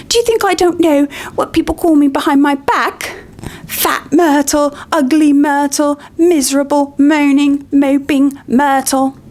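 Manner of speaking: high, wailing self-pity; tearful wail. Acted. Myrtle wails at Hermione through tears, high. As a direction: cry out every insult. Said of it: "Myrtle gasped, tears now flooding".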